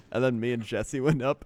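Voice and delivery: normal talking voice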